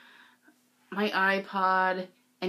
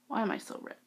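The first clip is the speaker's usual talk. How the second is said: usual speaking voice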